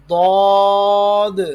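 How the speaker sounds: ضاد thick, high and very loud, ending in an audible d; heavy daad. Shown as a demonstration. Teacher models for pupils, heavy long ضاد. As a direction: puff the mouth and sustain ضاد loudly. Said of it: "پھلائیں گے منہ".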